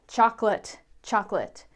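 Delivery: chocolate said as CHOCK-lit; two beats, chock-lit; two-syllable chocolate. Shown as a demonstration. Teacher showing the American CHOCK-lit. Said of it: "We just say chocolate".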